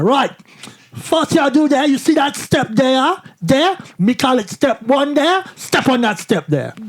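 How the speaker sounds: high, slow imitated Jamaican instructions; Jamaican accent. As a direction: point out each thing in a put-on Jamaican accent. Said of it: "really thick Jamaican accent".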